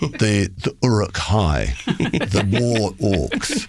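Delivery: drawn-out ominous delivery; slow menacing. Acted. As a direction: stretch it out, menacing. Describing fearsome crowd as orcs.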